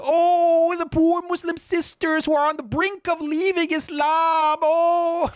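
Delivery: very high falsetto mock pity; shrill-free hammed-up falsetto sob story. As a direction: whine it like a melodramatic pleader. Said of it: "now she's appealing to".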